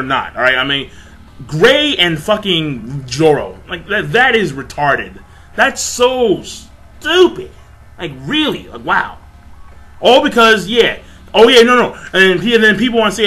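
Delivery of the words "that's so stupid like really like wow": high, slow sneer of disbelief; scoffing disbelief. Real, not acted. Vlogger scoffing at a fan comparison, sneering. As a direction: drag it out like it's absurd.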